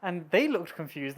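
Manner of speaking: ordinary delivery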